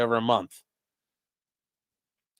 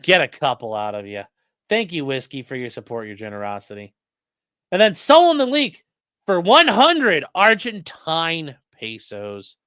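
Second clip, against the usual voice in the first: usual speaking voice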